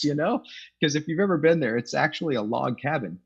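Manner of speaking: ordinary talk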